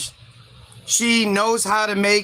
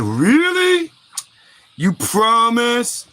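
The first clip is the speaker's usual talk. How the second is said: hopeful naive Eve; 3 dB louder, much slower than usual. Acted. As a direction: ask slowly like a gullible simpleton. Eve hopefully asks the snake.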